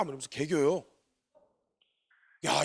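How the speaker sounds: ordinary delivery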